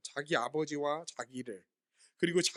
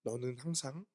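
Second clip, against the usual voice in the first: much softer and lower, gentle reassurance; tender father; 6 semitones lower, 9 dB quieter than usual. Retold or imitated. Father gently answering his angry elder son, tender. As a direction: speak softly, like calming a hurt child. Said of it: "다정하게 아버지가 응답해 주시는".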